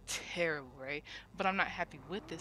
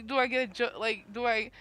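raised, higher retold rant; imitated yelling; 5 semitones higher, 6 dB louder than usual. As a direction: mimic his upset yelling, partly held back. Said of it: "he's yelling more".

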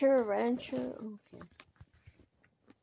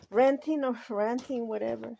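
usual speaking voice

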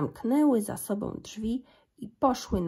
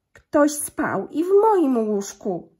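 very slow, higher, much louder mama bear; cieńszy głosik; 6 semitones higher, 9 dB louder, slower than usual. A narrator's reading. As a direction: call it slowly in a lifted, loud mama-bear voice. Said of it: "cieńszym głosikiem". (mama niedźwiedzica woła wolno, wyżej i głośno)

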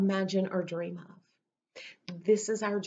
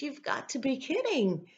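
disbelieving; 6 semitones higher than usual. Retold or imitated. Retold disbelief at coincidence.

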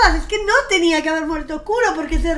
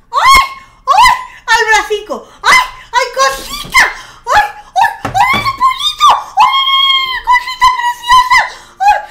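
shrill, very high delighted squeals, loud; squealing over cuteness; 12 dB louder than usual. Real, not acted. Streamer squealing at the baby bird, high excited shrieks.

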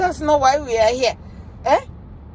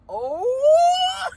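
long whoop sweeping up high; rising whoop; 12 semitones higher than usual. Real, not acted. Commentator relishing the imagined outburst, rising whoop. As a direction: let an oh soar into a hoot.

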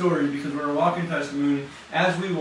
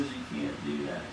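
quiet voice of God; 14 semitones lower, 9 dB quieter, slower than usual. Retold or imitated. Voicing God's reply.

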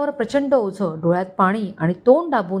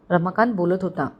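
ordinary delivery, nothing marked

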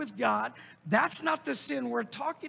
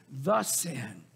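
"The" said as a heavy "thuh", set apart; stress on THE; 9 semitones lower than usual. Shown as a demonstration. Preacher pointing to a specific sin, stress on "The". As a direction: lean on "The" to single out one sin. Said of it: "he's talking about a specific sin".

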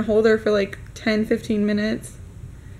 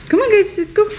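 much higher, louder come-on to the dogs; rally the dogs cheerfully; high pet-talk call; excited call to dogs; 9 semitones higher, 5 dB louder than usual. Real, not acted.

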